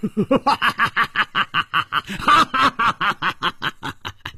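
booming high laugh thrown up to the sky; loud hearty laugh. A narrator's reading. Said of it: "仰天大笑". (仰天大笑)

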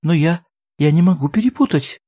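high, halting insistence; tearful. A narrator's reading. Woman insists she knows these men, tearful. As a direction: insist through tears. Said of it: "Она всхлипнула".

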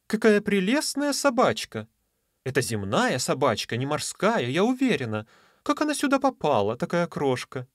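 high, cooing sea lady; sweet high mermaid voice. Acted. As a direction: coo over a tiny puppy. Mermaid admires the little dog.

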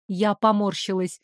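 usual speaking voice

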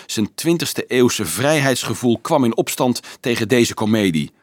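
ordinary delivery

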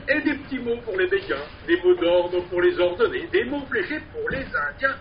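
lively recited street poem; singsong market cry. Acted. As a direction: perform it like a street barker. Performed poem with refrain.

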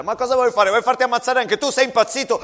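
loud, fast scolding of a reckless soldier; angry shout. Acted. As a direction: yell at him to stay down. Captain scolds soldier leaving trench.

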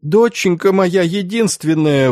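high-pitched wail of a bereaved father; wailing grief. A narrator's reading. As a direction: howl it out in grief. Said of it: "выл староста".

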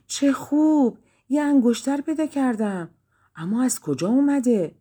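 high, bright-eyed delighted exclaim; a princess thrilled by her find; excited cry. A narrator's reading. Princess finds a golden ring in the garden, crying out in delight. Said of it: "شاهزاده خانم فریاد زد".

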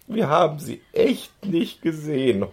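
high, tearful wail. A narrator's reading. Girl still wailing to the policeman, high. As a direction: keep crying it out. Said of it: "heulte sie weiter".

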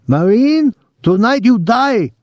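very high mock Japanese falsetto; hammed-up Japanese war-movie villain in falsetto; falsetto Japanese imitation. A narrator's reading. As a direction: threaten in a squealed fake Japanese accent. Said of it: "high falsetto in his Japanese imitation".

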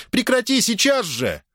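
high-pitched indignant command; mother crying out. A narrator's reading. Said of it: "вскричала Маргарита". (возмущённый окрик матери)

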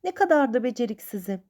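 understated acted exclamation of hopelessness; despairing cry. A narrator's reading. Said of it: "diye bağırdı".

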